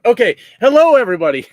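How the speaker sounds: loud high hello to the chat; excited greeting. Real, not acted. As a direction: burst in with a big hello. Greeting viewers loudly.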